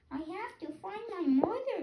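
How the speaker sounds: high, small chick voice, lost and asking; a baby bird piping for its mother. Acted. Hatchling searching for its mother, high piping voice. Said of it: "my baby bird voice".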